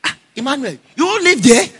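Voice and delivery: high, loud mock scolding of a schoolboy; scolding house master. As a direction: play the house master warning the boy, half laughing. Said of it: "and even the house master say".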